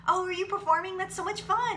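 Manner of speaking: high, loud, gushing fan voice; mock a bubbly stranger, way up high. Mimicking eager crowd member.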